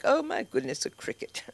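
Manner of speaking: high, hushed mock-genteel voice; high start fading to a near whisper.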